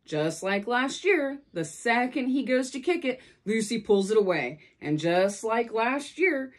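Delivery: ordinary delivery, nothing marked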